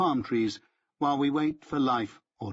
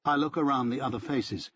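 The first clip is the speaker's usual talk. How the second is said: ordinary delivery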